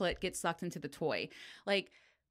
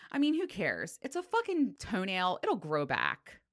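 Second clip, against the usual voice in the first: ordinary delivery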